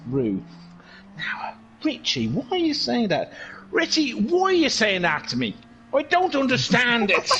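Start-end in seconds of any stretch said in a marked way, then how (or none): 1.2-7.3 hammed-up Dublin accent, indignant. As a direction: put on a broad Dublin accent. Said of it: "he sounded a bit like that".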